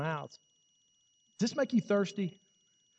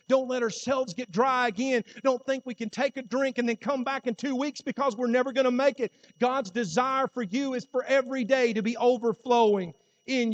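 loud, higher preaching push; rally the church; preaching at full force; 5 semitones higher, 4 dB louder than usual. Acted. Preacher urging the congregation daily, loud and high.

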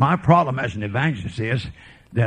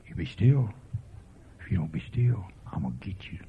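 soft, low, steady threat; low firm warning; 6 semitones lower, 8 dB quieter than usual. Retold or imitated. Father quietly warning his children in the pew. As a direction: quietly warn the child to be still. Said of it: "he could say that with just as much force".

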